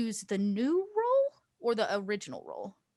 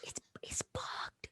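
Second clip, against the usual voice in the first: secretive whisper; 9 dB quieter than usual. Pretend. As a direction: whisper so the bug can't hear. Warning that the arm listens.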